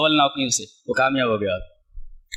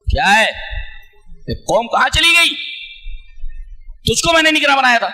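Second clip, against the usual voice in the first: angry Moses; 8 semitones higher than usual. Retold or imitated. Preacher voicing Moses rebuking his brother, angry shouting. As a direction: scold the brother hard and fast. غصے میں.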